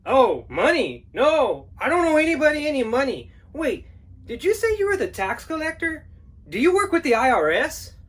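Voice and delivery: high, loud indignant homeowner; shrill up in outrage at the claim. Acted. Homeowner protests, pitch up.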